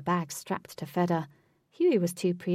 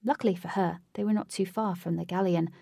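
plain reading voice

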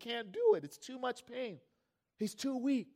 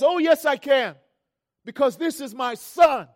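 loud, raised-pitch father's retort; acted fatherly defiance at full volume; defiant shout; 5 semitones higher, 12 dB louder than usual. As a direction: stand up to the guard for your child. Acts father defying the guard.

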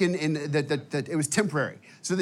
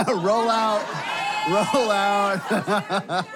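laughing shout; 4 semitones higher, much slower than usual. Real, not acted. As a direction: hype the crowd, stretch it out with a laugh. Pumping up crowd, calling out.

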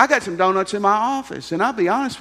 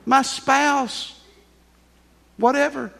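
mock complaining congregant; 9 semitones higher than usual. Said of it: "So you're going".

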